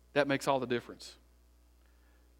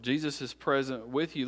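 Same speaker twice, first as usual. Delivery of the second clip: ordinary delivery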